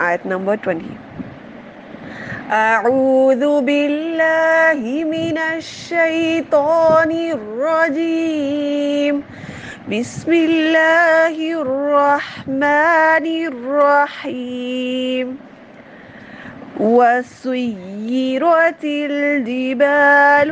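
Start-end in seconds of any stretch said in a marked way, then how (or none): 2.5-15.4 slow, drawn-out chanted recitation, pitched higher; Quran recitation. Shown as a demonstration. Teacher opening the lesson with slow chanted recitation. 16.8-20.5 slow melodic chant of the verse; Quran recitation. Shown as a demonstration.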